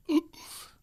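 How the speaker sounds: sharp, high breathless gasp from a body shot; gasp of pain. Retold or imitated. Boxer retelling a hook to the body, sharp gasp. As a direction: suck in air like you've been winded. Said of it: "and I went".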